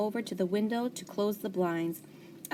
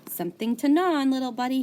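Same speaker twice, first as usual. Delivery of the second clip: cheerful janitor; 6 semitones higher, 5 dB louder, faster than usual. A narrator's reading. Janitor teasing hamster.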